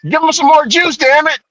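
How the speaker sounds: high, insistent egging-on voice; excited cop. Retold or imitated. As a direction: push him to tase again, pitched high. Officer insisting on more tasing.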